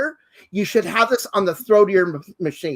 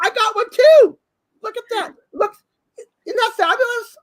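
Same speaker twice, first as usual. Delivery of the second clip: delighted squeal; 13 semitones higher than usual. Real, not acted. Host grabbing a Santa hat to match co-host, delighted squeal.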